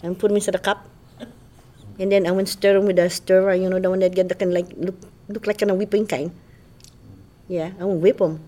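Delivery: casual Hawaiian pidgin storytelling. Shown as a demonstration. Describing breakfast to a friend, Hawaiian pidgin accent. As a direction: drop into local Hawaiian pidgin. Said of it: "have a conversation in pidgin".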